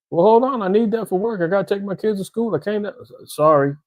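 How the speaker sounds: high, protesting plea; frantic pleading. Acted. Car owner begging the repo man, frantic pleading.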